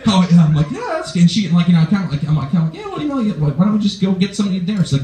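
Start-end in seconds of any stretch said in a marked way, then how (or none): 0.7-1.2 very high-pitched fake approval; feigned agreeableness. Boyfriend hiding his annoyance from girlfriend, mock agreeable. 2.8-4.8 high-pitched, over-agreeable suggestion; feigned casualness. Retold or imitated. Boyfriend suggesting food near her place, mock casual.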